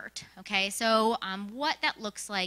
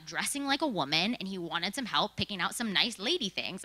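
ordinary talk

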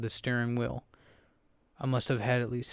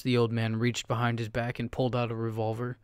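usual speaking voice

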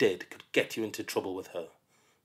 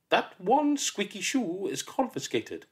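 high, slow, prim teacher voice; stern teacher; 10 semitones higher than usual. Acted. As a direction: pronounce it like a verdict.

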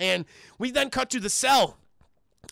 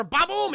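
higher, punchy spoken boom; imitated explosion; 9 semitones higher than usual. Storyteller imitates plastic blowing up prison doors, punchy boom. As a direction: voice the blast with gusto.

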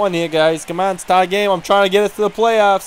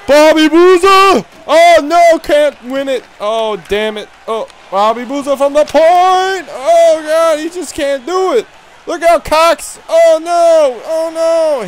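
much higher, louder commentary calls; excited play-by-play; 10 semitones higher, 5 dB louder than usual. Real, not acted. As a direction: call it like a frantic commentator. Excited play-by-play of own game.

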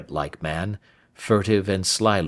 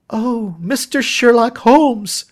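high, loud, drawn-out exclamation; agitated young woman calling out; excited cry; 6 dB louder, slower than usual. A narrator's reading. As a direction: cry out with overpowering excitement and concern. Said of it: "she cried".

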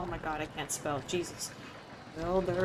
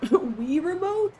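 laughing reading; 8 semitones higher, 6 dB louder, much slower than usual. Real, not acted. Reading a funny chat suggestion.

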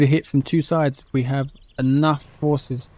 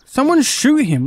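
urgent yell; 11 semitones higher, 5 dB louder than usual. Real, not acted. Under fire, yelling.

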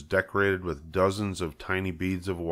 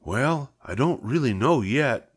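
slow, higher, unsure; hesitant farmer; 5 semitones higher than usual. Acted. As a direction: stall while searching for words. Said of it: "said Mister Zuckerman".

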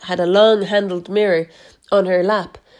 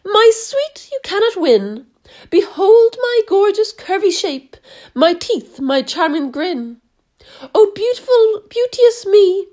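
much higher, preening and self-adoring; vain Aunt Spiker; 10 semitones higher than usual. A narrator's reading. Aunt Spiker admiring herself to Sponge, high and vain. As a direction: swoon over her own beauty. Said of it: "Aunt Spiker said".

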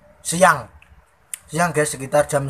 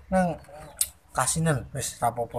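speaking between bites; chewing while talking; 8 dB quieter than usual. Real, not acted. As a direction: mouth partly full of food. Seen 0: Eating just before; grimacing, working jaw while speaking the words.

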